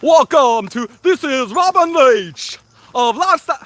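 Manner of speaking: high, put-on British TV host voice; Robin Leach impression. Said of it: "be able to do a Robin Leach accent".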